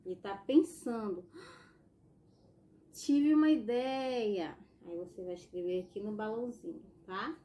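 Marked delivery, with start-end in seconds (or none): 1.3-4.6 gasp, then slow, louder and higher; light up with a bright idea; sudden idea. Acted. Teacher voicing the comic-strip boy who gets an idea, gasping and excited.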